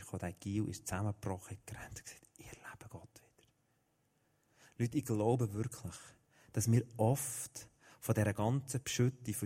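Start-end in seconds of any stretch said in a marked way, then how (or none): 0.0-3.5 unvoiced, hushed telling of the breakthrough; whisper. A narrator's reading. Preacher whispers how Gil broke down and felt God again. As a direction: breathe the words out in awe.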